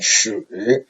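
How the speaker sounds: 始 dipped rather low; soft, low third-tone 始. Shown as a demonstration. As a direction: sink 始 to the bottom of the voice. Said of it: "make it rather low".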